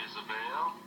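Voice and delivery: usual speaking voice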